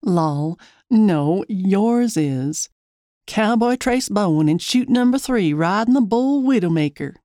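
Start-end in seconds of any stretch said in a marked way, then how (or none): 3.3-7.1 country twang like a rodeo announcer, a touch higher. Acted. Teasing the cowboy about his name, in a rodeo announcer's country twang. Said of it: "Using my twang again".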